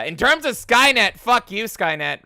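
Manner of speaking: loud, high mock outrage; angry shout. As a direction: yell it like a playful curse. Cursing out the robot donor.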